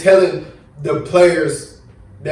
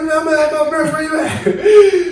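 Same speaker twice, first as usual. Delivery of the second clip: laughing through a raised, relaxed dad voice; daddy's reply; 12 semitones higher than usual. Retold or imitated. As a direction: play the clueless dad, chuckling. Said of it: "He's like".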